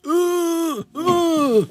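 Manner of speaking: high, hammed-up pained ooh sounds; mock pained groans. Retelling being punched, high mock groan. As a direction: squeal out the winded groans, half laughing. Knocked down by a stomach jab.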